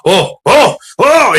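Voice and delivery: sudden high startled oh oh oh; freaking out. Retold or imitated. Trooper startled to learn he's carrying, high alarmed cries. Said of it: "he freaks out".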